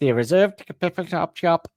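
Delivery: a hammed-up Welsh lyric; mimicking Welsh singer's words; mock Welsh accent. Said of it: "the Welsh is taking over".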